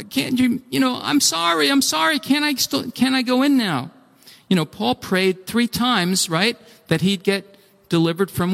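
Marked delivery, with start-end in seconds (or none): 0.1-3.9 high, halting, apologetic begging; pleading. Acted. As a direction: beg to be let in, stumbling over the plea. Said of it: "tells us he pleaded".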